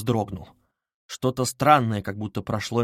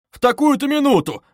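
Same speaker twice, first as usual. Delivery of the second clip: loud, high exclamation; raised voice, sharply pitched up; indignant cry; 13 semitones higher, 7 dB louder than usual. A narrator's reading. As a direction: cry out in protest. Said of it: "крикнул Разумихин".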